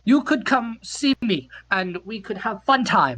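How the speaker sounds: slow, lightly put-on Norwegian accent; mock Norwegian accent. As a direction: invite him over as the Norwegian woman, slowly. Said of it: "this accent is".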